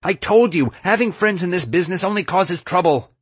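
high-pitched, heated retort; exasperated yelling. A narrator's reading. Yelled argument during rescue.

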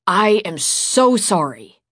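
"I am so sorry" slow and loud, each word weighted; emphatic apology. A narrator's reading. Boy apologizes to girl after scolding, "I am so sorry" said emphatically. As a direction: lean hard on "so" in "I am so sorry". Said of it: "Reese apologized emphatically".

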